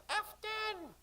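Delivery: put-on high sing-song chorus of children calling. A narrator's reading. Children calling to the sailors in sing-song. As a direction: call it out sweetly like a crowd of kids. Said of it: "dozens of sing-song voices of the children said".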